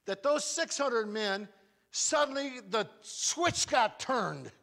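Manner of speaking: a preacher slowly lifting to the turning point; dramatic slow build. Acted. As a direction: stretch each word out for drama.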